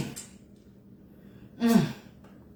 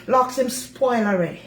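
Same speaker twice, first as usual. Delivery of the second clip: ordinary delivery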